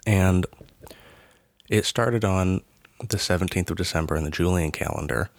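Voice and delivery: ordinary talk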